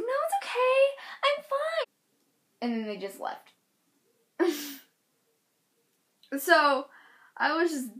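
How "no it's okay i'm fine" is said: very high sing-song voice; put-on squeaky all-is-well; mock reassurance. Hurt girl to departing parent, high mock reassurance.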